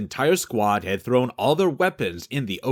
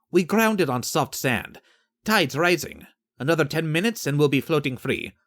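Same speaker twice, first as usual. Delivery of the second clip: higher, unbothered sailor's voice; tossing off the facts lightly, pitched up; Estefan's breezy voice; 5 semitones higher than usual. Acted. Estefan reassures the sergeant.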